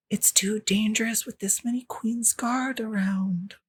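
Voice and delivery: frightened whisper in a secret argument; urgent quiet whisper. Acted. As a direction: whisper it like a scared young person arguing. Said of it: "two voices that are speaking very g-, quietly".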